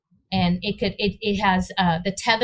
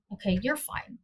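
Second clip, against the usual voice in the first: soft, hushed self-reassurance; quiet soothing words to oneself; calming herself; 9 dB quieter than usual. Retold or imitated. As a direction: talk yourself down gently, much softer. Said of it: "I was able to calm myself down".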